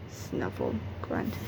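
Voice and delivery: very soft, breathy animal snuffle and grunt; a big unseen animal snuffling toward the camp; whispered snuffle and grunt. Acted. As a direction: hush it like a beast far off. Animal noises from forest.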